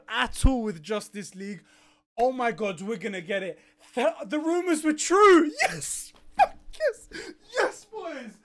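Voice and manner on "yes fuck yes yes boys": very high, cracking cry of victory; triumphant cry. Real, not acted. Fan telling the boys the rumors were true, cracking cheer. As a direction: celebrate like a goal was scored.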